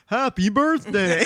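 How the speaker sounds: slow, drawn-out cartoon greeting; mock just-awakened snowman voice; Frosty the Snowman. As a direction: come to life with a slow, cheery hail. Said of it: "Frosty the Snowman vibes".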